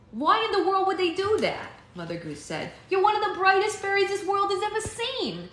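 shocked on her behalf; indignant surprise. A narrator's reading. Mother Goose indignant for Alex.

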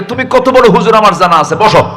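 high, loud retort as the wife; playful imitation of a telling-off; mock scolding. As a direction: imitate a wife cutting her husband down. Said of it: "এক ধমকে".

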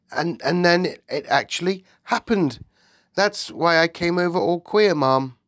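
high, earnest servant voice; agitated maid. Acted. The maid explains the dream came true, agitated. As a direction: insist anxiously on the coincidence.

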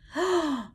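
sharp high startled gasp; surprised gasp. Acted. Reader gasps to the children as the broom snaps.

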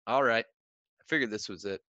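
ordinary delivery

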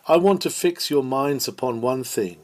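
ordinary delivery

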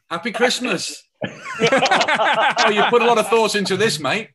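high, loud jokey voice for the gift-giver; mock gift-giver. Author mimics a mate giving a gift, high and loud. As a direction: ham up a chummy mate handing over a cheap present.

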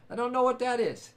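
ordinary talk, nothing marked

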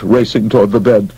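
plain reading voice

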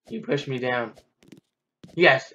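ordinary talk, nothing marked

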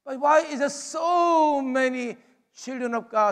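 usual speaking voice

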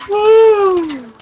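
loud high whoop; cheering. Real, not acted. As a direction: whoop with joy. Cheering a win.

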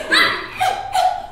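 high, loud acted scream; drowning scream. Woman pretending they are drowning, screaming. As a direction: scream as if going under.

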